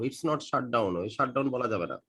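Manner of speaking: ordinary delivery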